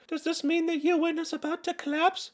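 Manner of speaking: put-on high, soft little girl voice; girly voice. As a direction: ask it like a sweet anime girl. Reading comment in girly voice.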